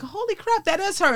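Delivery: high, breathless disbelief; stunned recognition. Retold or imitated. Spotting the TV star in the office, stunned and high. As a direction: gasp it out as the penny drops.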